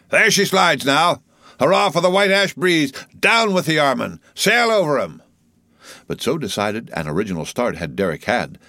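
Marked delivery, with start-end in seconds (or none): 0.1-5.2 loud, high, triumphant shouts; exhilarated cry. A narrator's reading. Three mates cheer their oarsmen forward, exhilarated shouts. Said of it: "with an exhilarating cry of".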